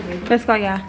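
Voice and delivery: ordinary talk, nothing marked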